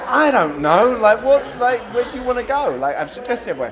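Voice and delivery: loud, exasperated whine of indecision. Retold or imitated. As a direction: throw up your hands, fed up with the back-and-forth. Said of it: "and you're like".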